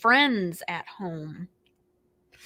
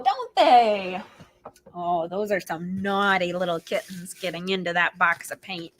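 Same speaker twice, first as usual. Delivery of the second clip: ordinary delivery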